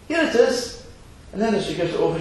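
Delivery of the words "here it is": put-on high little-girl voice; wee girl. Little girl answering the fisherman, high and bright. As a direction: chirp it like a small eager girl. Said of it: "runs back up to the fisherman".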